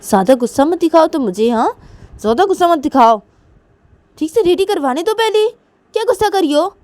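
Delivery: high, loud mother's reprimand; angry scolding. Acted. Manisha telling her daughter off while dressing her, loud scolding. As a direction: scold the child sharply.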